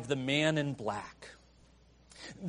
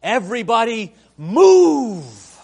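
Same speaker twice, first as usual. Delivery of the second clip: big shout, much louder and higher; great booming cry; 4 semitones higher, 9 dB louder than usual. Retold or imitated. Giant Fezzik orders the crowd aside, booming shout. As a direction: bellow it to part the crowd. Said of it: "cries out in a great voice".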